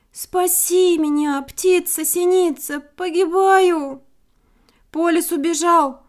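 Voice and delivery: loud, slow, pleading wolf voice. A narrator's reading. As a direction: beg for help with a broken leg. Trapped wolf begs for help.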